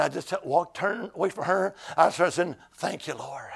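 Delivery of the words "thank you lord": quiet, lower murmured thanks; a soft, low private prayer. Retold or imitated. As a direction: breathe the thanks under your breath.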